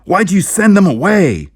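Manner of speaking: high, loud, slow desperate scream. Acted. Narrator screaming at the sheriff about the spacemen. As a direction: scream it at him in panic. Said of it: "I screamed".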